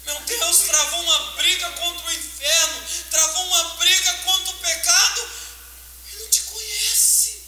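high, rising anguished preaching; full-force preaching lament; impassioned preaching. Acted. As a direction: climb to a pained peak.

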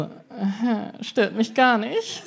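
slow, drawn-out mock nonchalance; fake indifference. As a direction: pretend it doesn't bother you at all. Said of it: "Und ich lieg daneben so". (langsam gespielte Gleichgültigkeit)

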